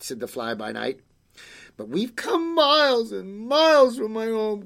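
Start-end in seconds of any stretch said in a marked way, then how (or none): 1.8-4.6 put-on high, wailing child voice; girl's cry. Girl protests to creature about the distance, high wail. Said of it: "cried the little girl".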